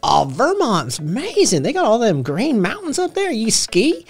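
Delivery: high-pitched, hammed-up Tennessee local; Tennessee drawl. Shown as a demonstration. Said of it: "get the good old proper Tennessee".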